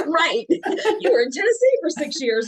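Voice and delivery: high, loud words through laughter; teasing, laughing reply; laughing while talking. Real, not acted. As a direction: talk through your giggles. Laughing about her accent.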